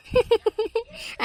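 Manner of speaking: high, teasing cackle; mocking laugh. Friend mocking the woman with back pain, high laugh.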